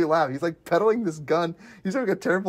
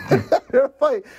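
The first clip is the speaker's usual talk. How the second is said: higher and louder, breaking into laughter; laughing through quote; 9 semitones higher, 5 dB louder than usual. Retold or imitated. Retelling the gun salesman's pitch, laughing mid-quote. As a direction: laugh out the words while quoting.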